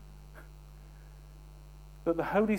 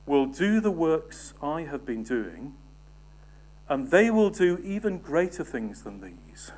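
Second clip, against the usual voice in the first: forceful scripture reading; 5 dB louder than usual. Acted. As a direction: proclaim each word to the congregation. Preacher reads scripture aloud.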